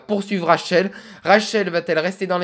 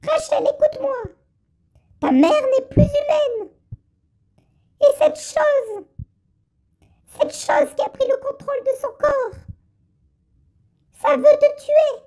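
put-on much higher, thin old woman's voice; little old lady voice. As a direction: squeak it out like a tiny grandma. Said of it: "on va faire une petite voix de vieille".

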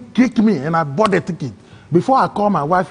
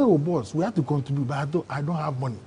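much softer, embarrassed mumble; sheepish admission; 5 semitones lower, 10 dB quieter, faster than usual. Retold or imitated. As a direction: confess you're broke, voice shrinking. Admitting to strangers he's broke.

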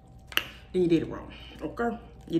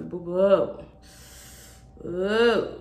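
usual speaking voice

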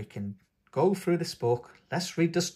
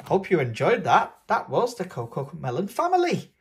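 usual speaking voice

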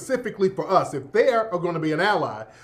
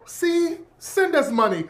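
pushy charity pitch; 8 semitones higher, slower than usual. Retold or imitated. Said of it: "I'm Sally Struthers".

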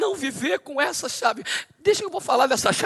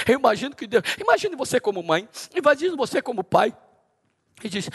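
ordinary talk

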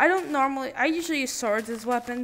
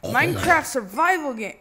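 higher, louder cocky brag; bragging loudly at the screen; excited boast; 6 semitones higher, 9 dB louder than usual. Real, not acted. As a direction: crow about your Survival Games skill. Boasting to the game.

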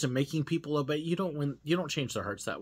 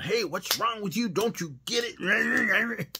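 higher, louder scolding, stretched out slowly; mock online rant; 8 semitones higher, 4 dB louder, slower than usual. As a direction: rant at someone on social media. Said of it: "not just jumping in and going".

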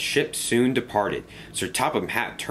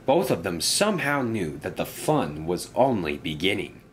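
plain reading voice

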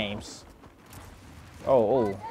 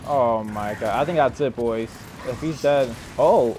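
ordinary talk, nothing marked

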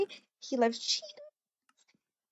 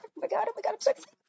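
excited squeal; 7 semitones higher, 4 dB quieter, much faster than usual. Real, not acted. Squealing over friend's visit.

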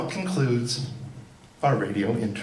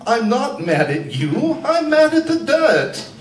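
much higher and louder, the words "I'm mad at the dirt" belted out; mock blurted outburst; 12 semitones higher, 5 dB louder than usual. A rival blurting back at Joan, hammed-up loud outburst. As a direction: blow up at the end of the line. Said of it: "she would turn around and blurt".

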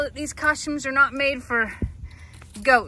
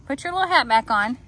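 usual speaking voice

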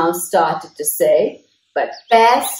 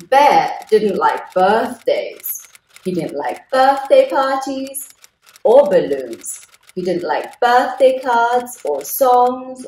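put-on reading to children; 3 semitones higher than usual. Storyteller reads aloud to children, lively and clear. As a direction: read it out to the little ones.

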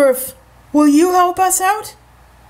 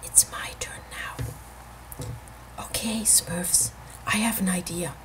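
whispering; 8 semitones lower, 13 dB quieter than usual. Real, not acted. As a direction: whisper the plan to the toys. Whispering plan to Smurfs.

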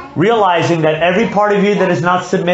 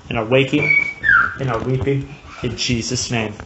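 closing prayer; 4 semitones lower than usual. Acted. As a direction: pray it humbly, fading down at the end. Closing prayer after sermon.

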